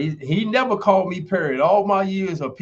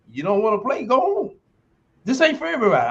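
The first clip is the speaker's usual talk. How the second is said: dismissive outburst; 7 semitones higher than usual. Acted. Emphatic dismissal of quitters.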